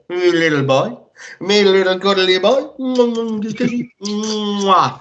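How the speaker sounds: high, slow doting pet voice. Real, not acted. Owner cuddling his Staffy on the podcast, high slow doting coo. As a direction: coo over him like a puppy.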